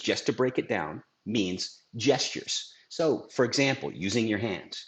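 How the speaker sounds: usual speaking voice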